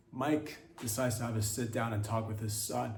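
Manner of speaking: normal talking voice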